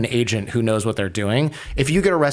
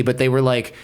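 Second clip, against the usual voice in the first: normal talking voice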